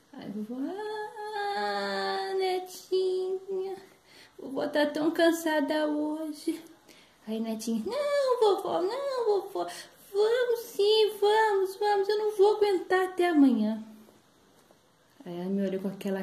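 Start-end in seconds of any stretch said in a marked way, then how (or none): 1.6-6.6 high, slow, drawn-out whine; singsong reluctant grandma; tired granny protest. Acted. 7.9-13.8 put-on high, loud child's begging; loud, high-pitched pestering; pleading granddaughter. Granddaughter begs to go.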